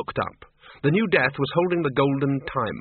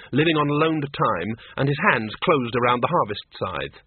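plain reading voice

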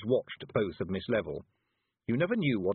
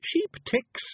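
very high little character voice; Billy, thoughtful; 4 dB louder than usual. A narrator's reading. Billy offers his thought to the others, very high voice. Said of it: "said Billy thoughtfully".

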